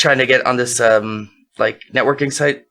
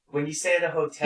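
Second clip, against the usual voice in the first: distant, off-mic voice; sounding far away; 9 dB quieter than usual. Real, not acted. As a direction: speak from across the room. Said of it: "You sound so far away".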